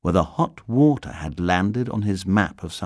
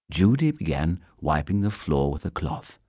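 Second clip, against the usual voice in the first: ordinary delivery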